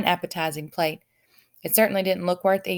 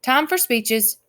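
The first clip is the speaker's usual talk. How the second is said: higher, louder royal announcement; announcing; 6 semitones higher, 5 dB louder, slower than usual. A narrator's reading. King announcing speeches to the banquet guests. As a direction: call the room to order. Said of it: "announced the king".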